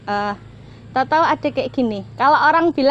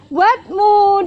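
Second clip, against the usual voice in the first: put-on higher, louder sweet child voice; child's gift line; 8 semitones higher, 6 dB louder than usual. Child giving the crafter a ring, higher and louder. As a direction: hand it over like a proud little kid. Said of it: "Terus dia bilang".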